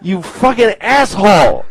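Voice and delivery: hammed-up angry comeback; Abraham's indignant protest. Abraham yelling back at God who woke him, indignant.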